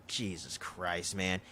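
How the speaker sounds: low, quiet, slow mock dismay; retold fed-up mutter; weary exasperation. Acting Wong's exasperation at screwup.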